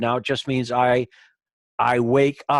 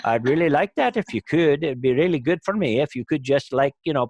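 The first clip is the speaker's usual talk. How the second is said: lightly voiced Irish leprechaun accent; a hint of a pleading Irish leprechaun. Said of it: "why you chose the leprechaun voice for that".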